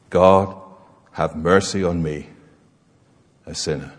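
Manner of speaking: low, soft, fading plea for mercy; humble plea. Acted. Tax collector begging God for mercy, low and hushed. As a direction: pray as a broken man with nothing to offer.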